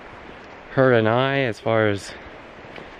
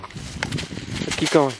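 whisper; 4 semitones higher, 4 dB quieter, much slower than usual. Real, not acted. Urging the dog on the trail.

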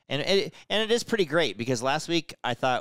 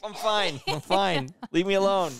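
whiny high voice; 9 semitones higher, slower than usual. Pretend. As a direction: brush them off, whining. Playing both sides of door.

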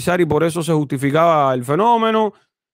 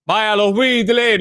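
mock cheering; 5 semitones higher, much slower than usual. Mock cheer for a fan's devotion.

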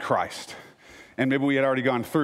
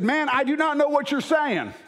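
light Southern US accent, pitched up; a little Southern; 12 semitones higher than usual. Shown as a demonstration. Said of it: "I put a little Southern on there".

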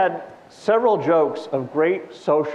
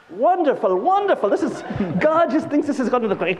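high, gushing approval; hammed-up rapture; ecstatic rabbi; 9 semitones higher than usual. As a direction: rave with mounting delight.

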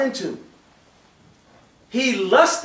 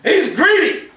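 indignant outburst; 9 semitones higher than usual. Acted. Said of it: "And they".

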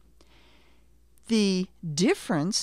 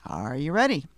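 ordinary delivery

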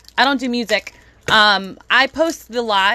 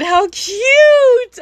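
high squeal of delight; overwhelmed by cuteness; 11 semitones higher, 6 dB louder than usual. Real, not acted. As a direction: squeal it out, gushing. Squealing over cute penguin.

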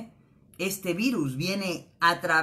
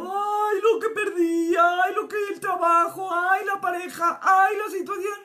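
loud, high-pitched theatrical wailing; hammed-up tearful ego bemoaning its losses; mock crying; 13 semitones higher, 7 dB louder than usual. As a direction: wail in an exaggerated lament, drawing out each "ay". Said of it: "está llorando".

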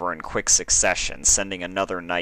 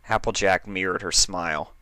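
ordinary delivery

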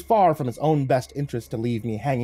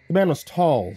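ordinary delivery, nothing marked

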